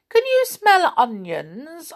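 put-on high, slow, curious old voice; funny old saucepan man. A narrator's reading. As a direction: sniff it out slowly and high.

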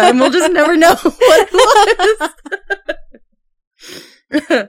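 laughing. Real, not acted. Laughing about lost episode.

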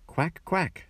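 high bright quacks; mother duck greeting her brood; mother duck quacking. A narrator's reading. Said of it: "said the mother".

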